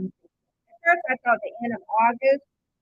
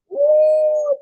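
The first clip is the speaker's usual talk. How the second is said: high, loud, held "ooh"; gleeful ooh; 13 semitones higher, 7 dB louder than usual. Retold or imitated. Host retelling finishing her video, gleeful. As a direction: let out a big high whoop of delight. Quotes own gleeful reaction.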